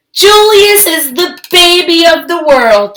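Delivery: high, loud singsong gush; chiming parents. Acted. Lily's parents gushing over baby Julius, singsong and doting. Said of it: "chimed Lily's parents".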